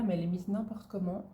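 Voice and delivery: ordinary talk, nothing marked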